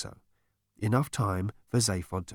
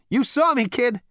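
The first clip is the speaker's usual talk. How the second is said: high, loud swaggering voice; cocky Zaphod; 13 semitones higher, 5 dB louder than usual. A narrator's reading. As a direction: brag like a smug hero. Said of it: "Zaphod brags after laughing".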